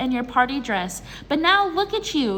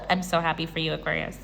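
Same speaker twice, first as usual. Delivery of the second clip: ordinary delivery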